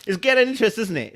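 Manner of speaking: oh this is juicy; excited teasing. Real, not acted. Reader teasing viewers about the plot, excited.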